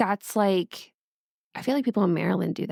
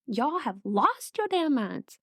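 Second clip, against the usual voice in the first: mock mad mom; 5 semitones higher, slower than usual. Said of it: "especially if I'm mad at my children".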